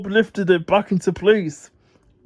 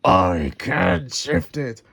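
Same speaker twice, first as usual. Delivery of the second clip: low, slow revving truck voice; 9 semitones lower, slower than usual. Acted. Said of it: "revved Muck".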